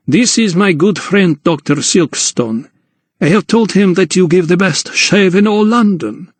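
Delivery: high-voiced little gentleman announcing; sociable little Count. A narrator's reading. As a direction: introduce a friend in a high, accented character voice. Count introduces his friend.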